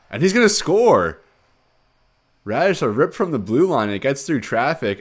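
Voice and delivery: high, very loud scoring shout; erupt, then ride the excitement down; excited goal call. Real, not acted.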